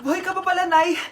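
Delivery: usual speaking voice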